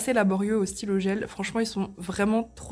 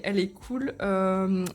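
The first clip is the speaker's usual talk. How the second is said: ordinary talk, nothing marked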